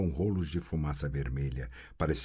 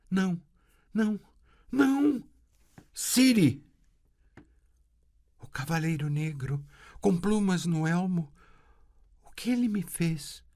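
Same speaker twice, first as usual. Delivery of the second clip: put-on a girl begging in terror; frightened girl pleading; terrified girl; 13 semitones higher than usual. A narrator's reading. Girl in panic, very high.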